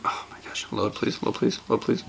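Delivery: hushed please-load mumble; muttered pleading. Real, not acted. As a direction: beg the device under your breath. Pleading with slow loading screen.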